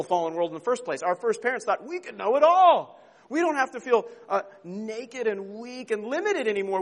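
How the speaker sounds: preacher warning the congregation, fervent; fervent preaching. Acted. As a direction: drive the point home from the pulpit. Preaching at full force.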